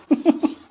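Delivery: short, high giggle; laughing. Real, not acted. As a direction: let out a quick high giggle. Amused after asking for food review. (छोटी, ऊँची खिलखिलाहट)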